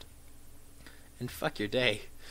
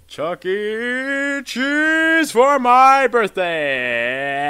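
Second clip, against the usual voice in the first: very slow, stretched-out call; drawn out; 7 semitones higher, 8 dB louder, much slower than usual. Real, not acted. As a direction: stretch every syllable long. Repeated spell, stretched out.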